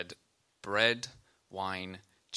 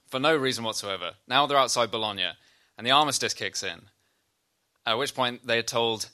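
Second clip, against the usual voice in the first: normal talking voice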